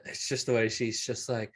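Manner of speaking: ordinary talk, nothing marked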